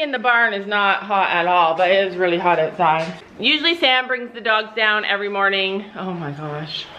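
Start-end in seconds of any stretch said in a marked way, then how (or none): none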